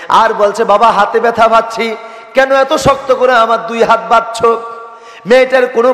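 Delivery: put-on high, loud sermon wail of a child's questions; impassioned preacher's pleading. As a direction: plead for the little girl in a ringing preacher's voice. (ওয়াজের সুরে উঁচু গলায় আকুতি)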